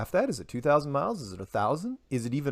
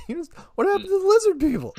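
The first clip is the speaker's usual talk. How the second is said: much higher, fast mock-baffled squeal; mock bafflement; 4 dB louder, faster than usual. Mocking a friend who dropped the lizard people, high and baffled. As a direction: squeal it like a stunned conspiracy friend. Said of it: "It's like".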